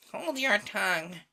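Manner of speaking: high, loud snap; sharp rebuke. Acted. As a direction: snap at her to hush. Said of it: "added the Gryphon".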